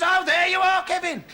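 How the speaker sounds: high, exclaiming greeting; programme boss. Acted. Programme boss finds Kevin at his door, high exclaiming voice.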